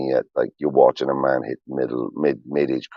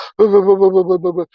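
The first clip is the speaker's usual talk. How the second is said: high, strange whimper mimicking a wobbly-chin cry; imitated kid's sob; 6 dB louder than usual. Dad showing chat how he bawled, high mock whimper. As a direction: act out crying like a kid. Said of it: "doing that".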